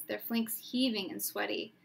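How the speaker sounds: ordinary delivery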